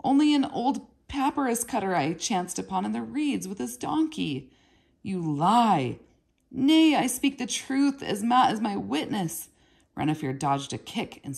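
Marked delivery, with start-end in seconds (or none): 0.0-4.5 high, pleading in panic; boy pleading with his brutal half-brother; frightened plea. A narrator's reading. 5.0-6.0 loud flat accusation; angry accusation. A narrator's reading. Gebu accusing angrily. 6.5-9.5 high, loud panicked denial; boy protesting his innocence in fear; desperate protest. A narrator's reading.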